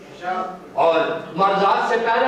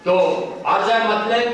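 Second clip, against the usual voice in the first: ordinary talk, nothing marked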